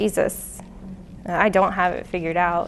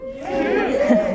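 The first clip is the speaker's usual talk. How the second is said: laugh. Real, not acted.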